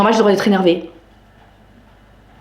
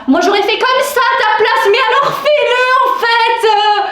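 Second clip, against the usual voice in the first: exasperated outburst; 10 semitones higher than usual. Acted. Woman answers people who always tell her what to do, exasperated. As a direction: snap back in a strained high pitch. Mocks advisers and snaps back at them.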